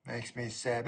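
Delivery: low, soft, sorrowful; put-on mournful murmur; mock sadness. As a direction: drop it quiet and low in feigned sorrow. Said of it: "shake my head sadly like this here".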